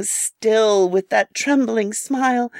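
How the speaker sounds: usual speaking voice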